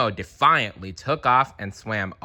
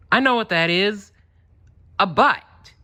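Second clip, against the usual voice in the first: put-on high, louder know-it-all kid; kid Tad; 12 semitones higher, 5 dB louder than usual. Tad showing off to his friends at the reef. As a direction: announce it like a proud little fish. Child fish Tad speaking.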